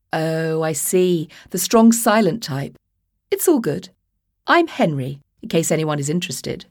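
chirpy posh toff making small talk; posh English accent, cheerfully oblivious. Acted. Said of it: "sounded like he'd swallowed a silver spoon".

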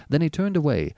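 ordinary delivery

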